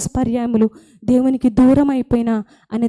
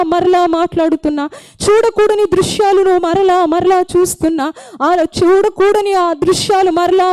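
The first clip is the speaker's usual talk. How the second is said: higher, fervent sermon cadence over music; impassioned preaching; 6 semitones higher than usual. Acted. Preacher urging sinners to return, rising fervour. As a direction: climb with each repeated line.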